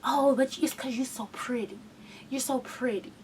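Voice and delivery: soft, creepy murmur; quiet mock-sweet voice; mocking abusers' excuse. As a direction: coo it like a creep excusing himself. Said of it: "that was the excuse I got".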